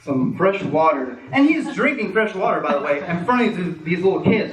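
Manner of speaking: ordinary talk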